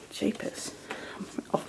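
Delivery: a breathy aside under her breath; whispered jeepers. Real, not acted. Crafter losing her place mid-video, whispered to herself. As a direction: whisper it to yourself, flustered.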